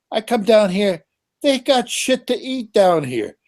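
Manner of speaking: high-pitched food gripe, held up throughout; real exasperation, pitched well up; exasperated complaint. Real, not acted. Complaining about food down here.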